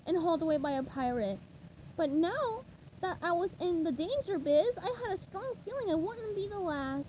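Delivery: high, bright cartoon sponge voice; SpongeBob voice. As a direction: narrate as an eager cartoon sponge. SpongeBob first-person narration.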